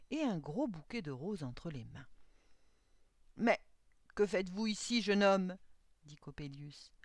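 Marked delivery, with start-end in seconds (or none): 3.4-5.6 loud, indignant challenge; startled old man. A narrator's reading. Old clockmaker to the young man at his door, loud challenge. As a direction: challenge the intruder loudly.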